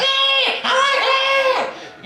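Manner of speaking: put-on very high, slow childish squeal of delight; drawn-out squeaky kid voice; child wanting sweets. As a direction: play a kid grabbing at sweets.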